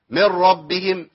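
من ربهم with the نون fully swallowed into the ر, slow; full merging, no n. Shown as a demonstration. Teacher showing students the full merge, slowly. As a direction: let من ربهم run as one word with no trace of nasal n. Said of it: "فين النون؟".

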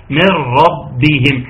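من ربهم drawn out slowly as merrabbihim; merged noon. Shown as a demonstration. Teacher repeats the correct merging for the students. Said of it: "مذاكرين كويس".

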